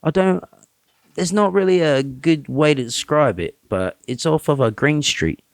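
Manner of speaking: a hint of Welsh-British storyteller, making it up as he goes; British accent. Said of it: "did a British accent the whole time".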